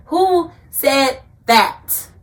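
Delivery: WHO, SAID, THAT, each word drawn out high and loud; slow, forceful challenge, punching "Who said that" word by word; emphatic challenge. Real, not acted. Challenging those who say grievers can't enjoy life, emphatically.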